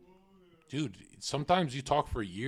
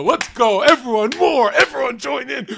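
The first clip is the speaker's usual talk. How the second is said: much higher, loud gleeful cheer; mock rallying shout; 12 semitones higher, 13 dB louder than usual. Streamer retells cheering on his arguing family, in a loud gleeful shout. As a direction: whip up the family fight like a hype man. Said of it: "I go".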